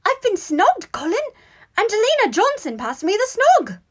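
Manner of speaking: very high, loud squeals of excitement; bursting with joy. A narrator's reading. Boy telling his brother great news, overjoyed squeal. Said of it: "with joy".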